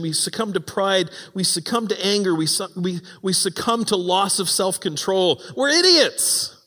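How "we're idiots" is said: higher, louder, slow declaration; emphatic punchline. Real, not acted. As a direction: land the joke on ourselves. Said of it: "self-mocking punchline".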